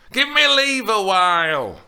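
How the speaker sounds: put-on high, loud tired old woman; the nurse begging for rest; weary old nurse. A narrator's reading. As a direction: wave her off, worn out. Weary nurse dismissing Juliet.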